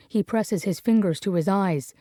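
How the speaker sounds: ordinary delivery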